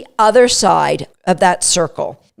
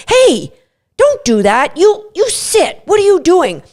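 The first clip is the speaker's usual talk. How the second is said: owner yelling at dog; 7 semitones higher than usual. Retold or imitated. Owner scolding an anxious dog: sharp, raised commands. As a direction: snap at the dog to sit.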